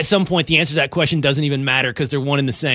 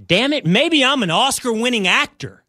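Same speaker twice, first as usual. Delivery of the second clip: high-pitched, hammed-up self-praise; mock boasting; 7 semitones higher than usual. Host declares himself an Oscar-winning actor, mock-grand boast. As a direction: proclaim it with swelling grandiosity.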